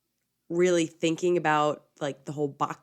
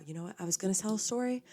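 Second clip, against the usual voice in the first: quick, softer, half to herself; hesitant aside; 8 dB quieter, much faster than usual. Real, not acted. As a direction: trail off as if backing out. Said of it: "No, I am gonna tell a story".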